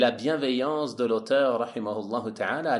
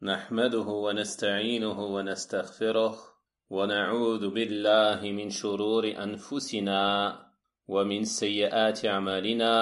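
measured, even-toned Arabic recitation; formal Arabic sermon opening; 3 semitones lower, faster than usual. Acted. Lecturer opening his lesson with praise of Allah, formal recitation. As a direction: intone the opening praise on a level pitch.

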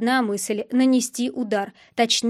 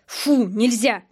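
slightly raised forbidding order; firm command; slower than usual. A narrator's reading. Hero dragging the dog away, firm command. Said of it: "скомандовал я".

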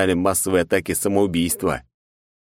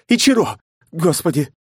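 desperate scream; 11 semitones higher, 4 dB louder than usual. Acted. Knight screams at explosion.

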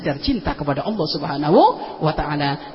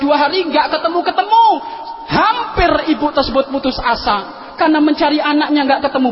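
high, loud dramatic retelling; impassioned story; 6 semitones higher, 4 dB louder than usual. A narrator's reading. Preacher telling the lost child story, impassioned. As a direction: tell the war story with fire.

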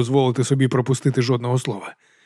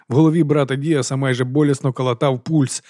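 ordinary delivery, nothing marked

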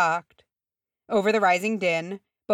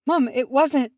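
high, loud, gasped protest; shocked plea to mum; 8 semitones higher, 6 dB louder than usual. A narrator's reading. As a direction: gasp it out in alarm. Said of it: "Gimri gasped".

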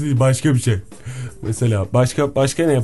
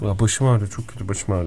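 low mumbled complaint, fading softer; suffering man; 6 semitones lower, 5 dB quieter than usual. Acted. Man telling his friend his head hurts, grumbling. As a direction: moan it low and sink into softness. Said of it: "Sen erkek yapıyor".

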